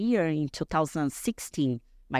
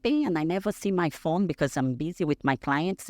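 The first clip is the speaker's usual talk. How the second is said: usual speaking voice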